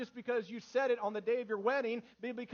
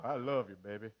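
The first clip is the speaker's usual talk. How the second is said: very low, smooth mock-romantic croon; deepest voice; 12 semitones lower than usual. Shown as a demonstration. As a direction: drop to your deepest register for a sweetheart. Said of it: "Get your deepest voice out and go".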